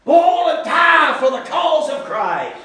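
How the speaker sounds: fervent pulpit shout; shouted fervor. Shown as a demonstration. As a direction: lift it up like a rallying cry. Preacher shouting the ideal.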